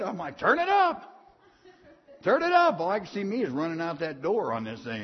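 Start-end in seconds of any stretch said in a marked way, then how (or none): 0.4-2.8 eager patient on the treadmill shouting to the nurses, high and loud; excited retold shout.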